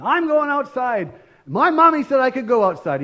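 put-on a two-year-old demanding his way; insistent toddler.

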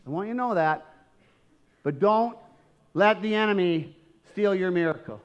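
ordinary talk

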